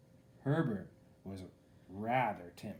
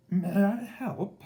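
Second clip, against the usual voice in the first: timid dragon; 10 semitones higher, 10 dB louder, slower than usual. A narrator's reading. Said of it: "he asked".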